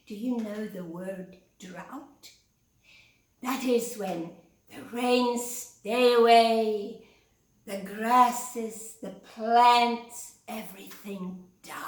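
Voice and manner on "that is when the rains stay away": usual speaking voice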